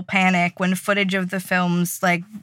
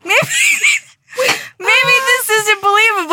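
mock dramatic wail; 13 semitones higher, 4 dB louder than usual. Studio execs voiced, mock panic.

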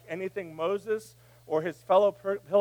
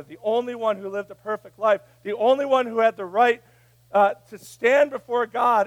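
rising louder preacher's voice; building preaching; 6 semitones higher, 10 dB louder than usual. Acted. Pastor to congregation about Jesus, building louder preaching. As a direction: build it up toward God.

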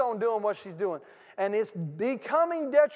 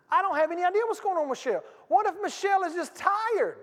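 much higher, louder, fast outburst; full-force preaching; 11 semitones higher, 5 dB louder than usual. Acted. Pastor rebuking judgmental church folk, fervent high preaching. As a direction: fling the question at the crowd.

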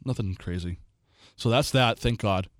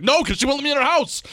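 high, raised comeback to nagging friends; exasperated outburst; 11 semitones higher, 4 dB louder than usual. Retold or imitated. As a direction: fire it back loudly, fed up. Exasperated retort to teasing friends.

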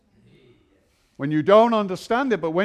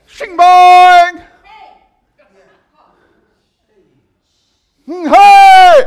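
shouted sound effect; 12 semitones higher, 16 dB louder, much slower than usual. Acted.

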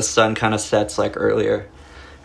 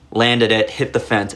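ordinary talk, nothing marked

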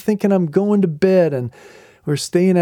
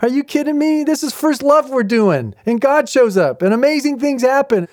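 excited; 8 semitones higher than usual. Real, not acted. Marvelling at God showing up.

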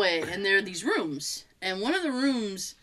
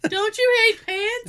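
laughing; 12 semitones higher, 3 dB louder, slower than usual. Real, not acted. Joking about dropping pants.

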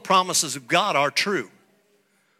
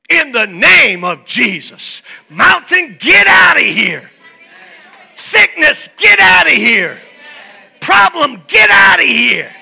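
loud, high commanding rebuke; commanding shout; 8 semitones higher, 12 dB louder, slower than usual. Shown as a demonstration. Pastor showing how to rebuke the mountain, shouting. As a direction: bark it with full authority. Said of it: "the Holy Spirit in me speaks like that".

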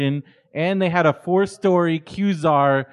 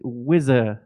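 attempted British accent; 5 semitones lower than usual. Guest copies a Skins character, lower mock British. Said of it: "one of the characters always says shit like".

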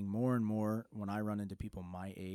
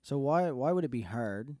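voice cracking; 5 semitones higher, 7 dB louder than usual. Real, not acted. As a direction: voice cracks high and loud. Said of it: "ooh, my voice just cracked".

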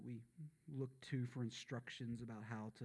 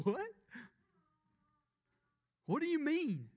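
put-on dumb southern accent; 7 dB louder than usual. Pastor voicing confused disciples, mock southern accent. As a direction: act stupid with a thicker southern accent. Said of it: "My accent gets more southern when I act stupid".

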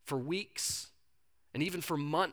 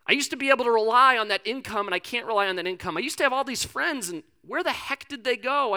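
worried churchgoer; 7 semitones higher, 3 dB louder than usual. Retold or imitated. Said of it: "You're going".

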